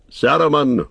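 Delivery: calling the name out loud with authority; a commanding wizard hailing the tower; loud commanding cry. A narrator's reading. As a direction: summon him with a firm ringing call. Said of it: "he cried in a loud, commanding voice".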